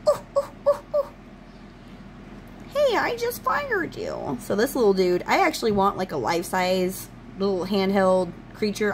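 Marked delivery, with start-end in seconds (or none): 0.1-4.4 high, slow goblin voice; fired goblin impression. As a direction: whine it like a tiny fired goblin. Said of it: "the guy who fired him".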